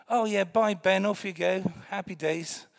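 high, loud, breezy send-off; cheery mock goodbye. Parents cheerfully waving their son off to the neighbours. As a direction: wave the kid off with mock cheer.

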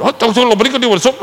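loud, high, angry rebuke; mock furious telling-off; shouted Polish scolding. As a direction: bark it like an outraged clerk. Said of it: "And they went".

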